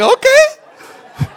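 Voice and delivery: squeaky high, loud, laughing okay; a surprised little yelp of okay; startled okay. Retold or imitated. Pastor caught off guard by the woman, laughing high squeak.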